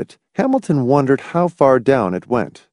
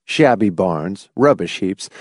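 plain reading voice

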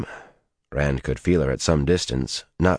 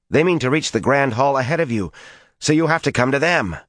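frantic; 11 semitones higher than usual. A narrator's reading. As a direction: plead it in a frantic rush. Min frantically warning Rand.

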